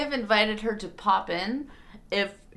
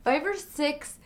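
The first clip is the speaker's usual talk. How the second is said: surprised; 9 semitones higher, 5 dB quieter than usual. Real, not acted. Shocked at cookie count.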